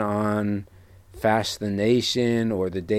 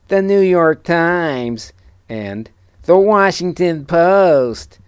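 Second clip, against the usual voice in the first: whiny nasal voice; 8 semitones higher, 7 dB louder, slower than usual. Acted. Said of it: "a weird, whiny, nasally voice".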